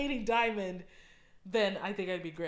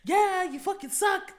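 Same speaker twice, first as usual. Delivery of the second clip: mocking voice; 10 semitones higher, 6 dB louder, slower than usual. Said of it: "It's like".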